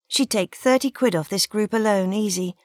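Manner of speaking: usual speaking voice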